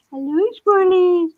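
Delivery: put-on high, loud baby talk to squirrels; cooing at squirrels.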